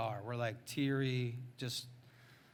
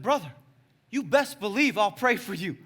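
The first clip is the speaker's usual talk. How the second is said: loud, raised-pitch promise; emphatic call back; 7 semitones higher, 10 dB louder than usual. Retold or imitated. Preacher answering the boy on the rock, loud and emphatic.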